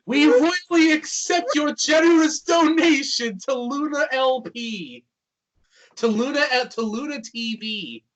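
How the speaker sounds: high, loud royal streamer voice; cute anime streamer. Pretend. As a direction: regal thank-you for the donation, high and loud. Luna voiced as a Twitch streamer.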